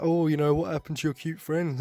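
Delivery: mock taunt. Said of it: "he's like".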